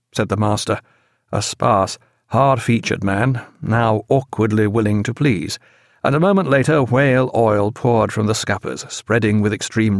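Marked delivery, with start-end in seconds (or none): none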